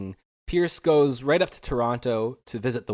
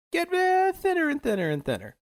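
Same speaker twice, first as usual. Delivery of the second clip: much higher, thinned-out voice; playfully thinning voice. Pretend. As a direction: lift the voice high as if it is wearing thin. Playfully voices documents getting thinner.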